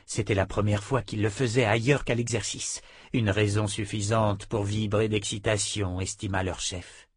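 ordinary delivery, nothing marked

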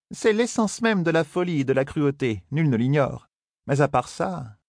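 lightly voiced stern Aes Sedai. A narrator's reading. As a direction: speak as a calm, knowing sorceress correcting a boy. Verrine voiced by narrator.

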